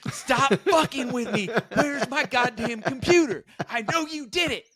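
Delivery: very high, hammed-up angry yelling; mock the roommate's shrieking meltdown; imitated furious roommate. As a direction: accuse him in an over-the-top, high-pitched rant. Retold roommate in a rage.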